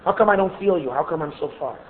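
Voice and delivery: soft, low question trailing off; quiet searching plea, falling away; plea to God. Acted. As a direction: ask God softly, as one who feels distant. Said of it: "We say to Rebbeinu Shalom".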